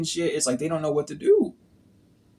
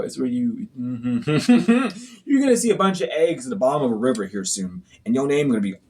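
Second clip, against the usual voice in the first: ordinary delivery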